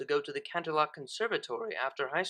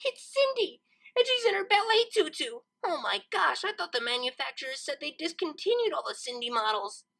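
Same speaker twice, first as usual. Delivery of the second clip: squealing with delight; 10 semitones higher than usual. Acted. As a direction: squeal over the find. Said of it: "she was squealing in fresh delight".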